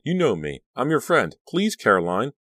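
high, loud pleading; urgent, frightened appeal; desperate cry. A narrator's reading. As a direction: plead to be recognised. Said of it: "Danielle cried".

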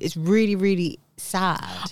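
ordinary talk

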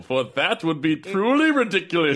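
character voice. Acted. Playing cat character.

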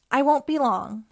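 lightly squeaky promise; a hint of an eager little squeak. A narrator's reading. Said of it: "she squeaked".

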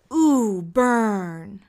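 a light Easy A style teasing ooh with a rising tune; Emma Stone voice. Retold or imitated. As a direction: tease the burn with a lightly voiced sarcastic movie girl. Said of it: "the Emma Stone voice from Easy A".

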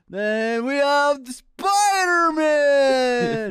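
high, loud, slow ring announcer boom. Acted. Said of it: "Then he says".

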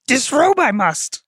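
high mock alien sage voice; Yoda. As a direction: play the little Jedi master making a bawdy confession. Joking as Yoda, inverted syntax.

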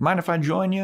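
mock-sweet roommate; sweet Kathy. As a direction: ask in a falsely sweet tone. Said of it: "She's like".

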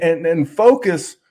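ordinary talk

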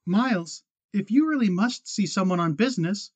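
put-on quick urging girl. A narrator's reading. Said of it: "She said quickly".

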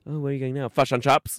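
loud, high "Fish and chips" in a hammed-up New Zealand accent; mock New Zealand accent. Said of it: "New Zealand accent".

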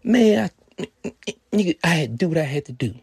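high, loud, fast and defiant; Darius's voice. Retold or imitated. Darius shutting down the narrator, high, loud and defiant. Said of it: "He said".

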